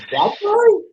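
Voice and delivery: very high, astonished question; squeaky-high acted disbelief; mock incredulous. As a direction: imitate a wife in utter disbelief, voice leaping up. Retold wife's astonished disbelief.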